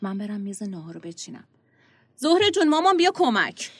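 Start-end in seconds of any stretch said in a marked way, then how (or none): 2.2-3.7 high, loud call across the house; loud high call to a daughter in another room. Acted. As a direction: call her to come help. Mother calls daughter from kitchen.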